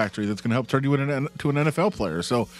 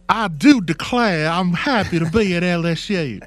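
high, slow, hammed-up Southern accent; Foghorn Leghorn Southern drawl; 6 semitones higher, 4 dB louder, slower than usual. Co-host mocking the coach's new Southern accent, slow and high. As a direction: ham up a cartoon Southern accent. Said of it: "It's Foghorn Leghorn".

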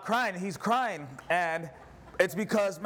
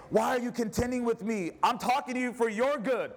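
high, pleading prophet's voice, much higher throughout; a preacher voicing Moses begging the people to obey, high and impassioned; 8 semitones higher than usual. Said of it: "I'm tired of feeling like Moses and saying".

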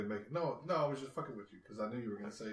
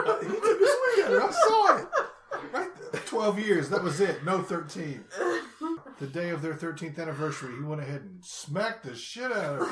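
high, loud hammed-up bystander; hammed-up shocked eyewitness voice, laughing; mock witness voice; 8 semitones higher, 8 dB louder than usual. Mock witness telling the scene.

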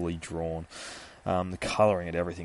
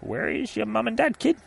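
much higher, fussy worried vendor; concerned ice cream man; 13 semitones higher than usual. Retold or imitated. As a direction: ask the kid with mock concern. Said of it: "He's like".

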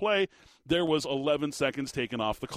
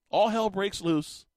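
ordinary talk, nothing marked

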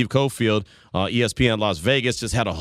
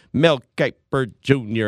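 MEL KIPER JUNIOR slow, word by word; drawn-out name announcement; slower than usual. Shown as a demonstration. Radio host teasing a pundit's name, slow and deliberately chopped up. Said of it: "have to say it like that".

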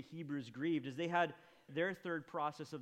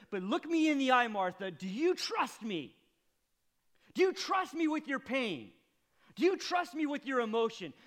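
higher, louder pleading as Jesus; Jesus urging Martha, loud and pleading; impassioned plea; 7 semitones higher, 7 dB louder than usual. Acted. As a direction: press her hard to trust.

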